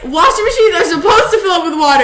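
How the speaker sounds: high, loud, laughing explanation; retold exasperation. Sister retelling her explanation to crying brother, loud exasperation. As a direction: spell out the obvious, acting exasperated.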